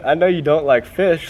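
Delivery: ordinary delivery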